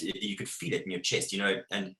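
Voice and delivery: normal talking voice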